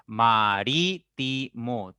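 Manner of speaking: ma-rí-ti-mo spoken slowly, each syllable split; teacher slowly splitting "marítimo" into its syllables. Shown as a demonstration. Teacher to class, slowly breaking "marítimo" into syllables. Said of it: "si ustedes pronuncian pausadamente".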